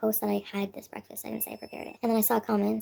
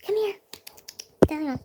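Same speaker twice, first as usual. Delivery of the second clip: calling the cat; 8 semitones higher, slower than usual. Real, not acted.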